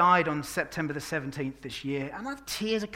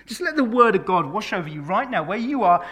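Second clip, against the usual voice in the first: ordinary delivery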